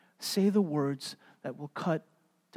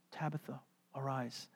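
soft command; 3 semitones lower, 14 dB quieter than usual. Retold or imitated. Quiet quote.

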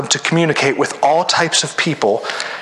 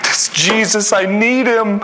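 mock weeping plea; 6 semitones higher, slower than usual. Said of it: "the person's weeping and like".

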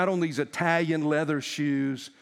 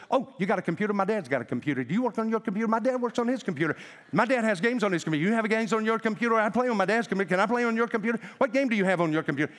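put-on high, fast toddler chatter; chattering little girl; 5 semitones higher, much faster than usual. Little girl pestering the yuppie passenger, high fast chatter. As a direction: rattle off eager questions like a two-year-old. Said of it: "jabbering, like Daffy Duck".

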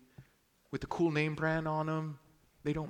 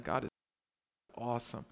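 ordinary talk, nothing marked

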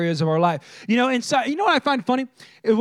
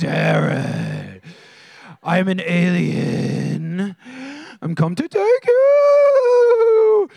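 creepy alien voice; 3 semitones lower, 3 dB louder, much slower than usual. Retold or imitated. Mom waking her young son as a creepy alien. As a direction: play a spooky alien coming to take a child. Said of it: "She started whispering in this voice".

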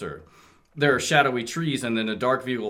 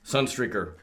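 ordinary delivery

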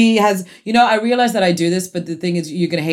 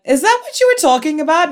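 excited question; 9 semitones higher than usual. Retold or imitated. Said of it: "I'm waiting for someone to go like".

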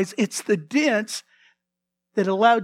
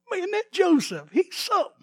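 higher, lightly voiced admiring boss; a hint of an impressed Potiphar; impressed master; 8 semitones higher than usual. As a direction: marvel at Joseph. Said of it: "Potiphar's going".

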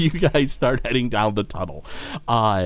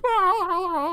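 long, high cry fading away; thin high receding "aaah"; distant echoing wail. Acted. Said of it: "you hear the echoing voice of Gorbo Borgo".

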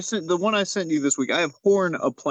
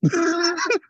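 high squealing laugh. Real, not acted. Amused squeal.

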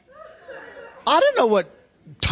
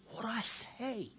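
much softer, slow, lost question; softly baffled; 16 dB quieter, slower than usual. Retold or imitated. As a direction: ask it small and helpless. Confused man asks the pastor.

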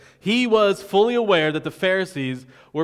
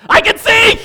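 much higher and louder ecstatic cry; joyful shout; 13 dB louder than usual. Acted. Healed blind man shouting to everyone, ecstatic high yell. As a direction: shout it like you just got your sight back.

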